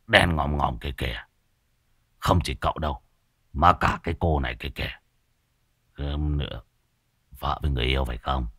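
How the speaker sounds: low, hushed ominous warning, sinking to near silence; sinister soothsayer. Acted. Old sorcerer warns the couple of ghosts, low hushed menace. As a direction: spook the young couple with a dark hushed prophecy.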